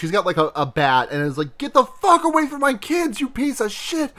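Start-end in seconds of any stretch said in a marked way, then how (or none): 1.6-4.1 high-pitched acted rage; mock-furious threat, pitched way up; furious mother. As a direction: screech it as a protective mother, without much volume. Mother attacking with a bat.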